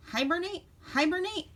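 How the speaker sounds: put-on old grump announcing. A narrator's reading. Old woodchuck calling the animals to hibernate, grumpy announcing. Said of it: "announced the old grump".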